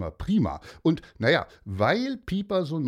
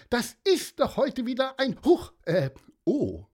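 erschrocken; 12 semitones higher than usual. Acted. Mann erschrickt.

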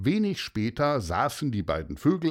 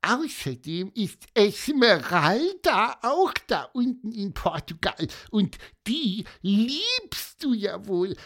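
teasing high bird voice; 10 semitones higher than usual. Acted. Pieper teasing.

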